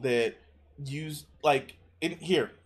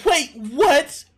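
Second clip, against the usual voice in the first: surprised; 11 semitones higher, 6 dB louder than usual. Real, not acted. Replays clip, repeats reaction.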